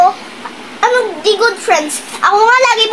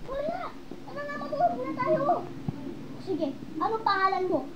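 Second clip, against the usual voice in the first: eerie soft voice; 16 dB quieter than usual. Acted. Possessed girl speaking very softly.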